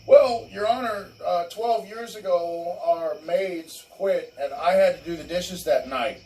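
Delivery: high, loud and slowed, pleading to the judge; mock outraged wife begging the judge; mock whiny ex-wife. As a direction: ham up a spoiled wife complaining in court. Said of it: "they'll be like".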